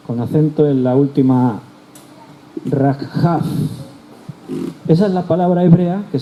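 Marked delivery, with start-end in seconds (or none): 2.7-3.4 "Rakhaf" said with a rasping kh, as in Hebrew; Hebrew word pronounced. Shown as a demonstration. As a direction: sound out "Rakhaf" with the throat kh. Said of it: "Te deletreo". ("Rakhaf" con la jota gutural hebrea)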